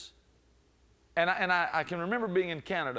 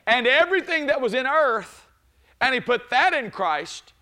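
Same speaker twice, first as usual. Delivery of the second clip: preacher rising to a shouting peak; preaching at full force; 7 semitones higher, 8 dB louder than usual. Acted. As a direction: lift the church with each phrase.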